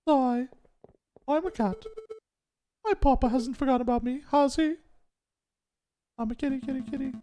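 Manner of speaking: very high, sweet cat lady voice; high cute cat character voice; squeaky cat girl. Acted. Voices cat lady Dame Cataline.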